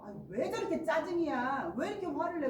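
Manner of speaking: loud, high annoyed complaint; irritated. Acted. Listeners reacting to someone's anger, irritated. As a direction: snap back, acting out caught annoyance. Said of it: "여러분들도 같이 짜증나고".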